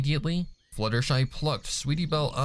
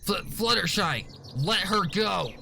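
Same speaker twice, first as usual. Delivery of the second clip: much higher, a firm stammered order; demanding; 9 semitones higher than usual. Acted. Scootaloo demands Fluttershy release her friend. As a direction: demand she lets go. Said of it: "Scootaloo demanded".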